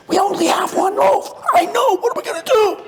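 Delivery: very high, whiny wail of a disciple; panicking disciple. Acted. Disciples panicking over one loaf, high whiny wail.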